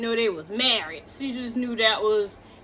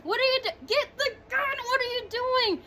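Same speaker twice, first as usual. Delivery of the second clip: much higher, softer, almost crying in disbelief; frantic high plea; 11 semitones higher, 5 dB quieter, faster than usual. Retold or imitated. Viewer begging the frozen man on screen, high and teary. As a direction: squeal it in panicked frustration. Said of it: "and I'm like".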